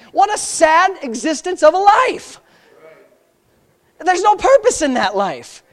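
high-pitched, urgent challenge; fervent pulpit questioning; bold preaching. Real, not acted. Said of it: "can I just really get bold here?".

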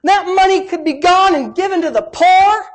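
high, loud scornful complaint; mocking the complainers. Preacher mimics church critics griping about the gift, scornful. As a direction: sneer it like a stingy critic. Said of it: "But people said".

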